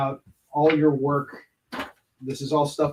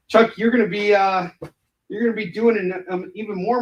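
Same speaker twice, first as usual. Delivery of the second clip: raised, higher voice calling out; calling out to Chuck; 6 semitones higher, 5 dB louder than usual. Real, not acted. Talking up to Chuck across the room, loudly.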